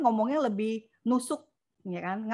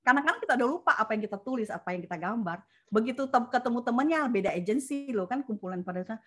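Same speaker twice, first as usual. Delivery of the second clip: usual speaking voice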